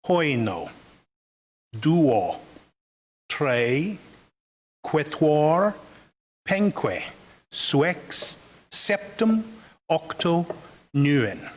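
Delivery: slow, spaced-out recital, one word at a time; reconstructed-language recitation. Shown as a demonstration. Lecturer demonstrating a string of ancient words, measured recitation. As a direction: intone each ancient numeral separately, with long pauses. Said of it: "listen to this string of words".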